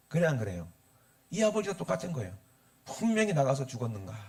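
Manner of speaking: ordinary talk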